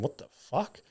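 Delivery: barely audible inner what the fuck; mouthing it silently to himself; under his breath. Retold or imitated. Shocked onlooker thinking it, nearly silent. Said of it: "In my head".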